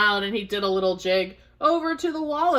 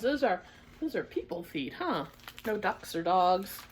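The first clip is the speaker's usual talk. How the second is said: soft chat over the pictures; quiet aside; 11 dB quieter than usual. Real, not acted. Aside about pictures to children.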